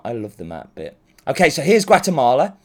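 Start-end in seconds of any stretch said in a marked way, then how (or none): 0.0-1.0 soft, low, sincere aside; quiet aside. Real, not acted. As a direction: murmur it fondly. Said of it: "quiet aside".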